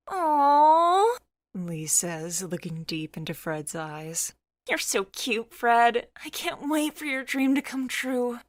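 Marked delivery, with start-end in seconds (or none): 0.1-1.2 high, loud gushing coo; gushing aww. Acted. Lee coos at Fred's confession. 4.7-8.4 higher, doting voice for Lee; a smitten friend cooing praise, pitched up; Lee, gushing. Acted. Lee's voice in the story.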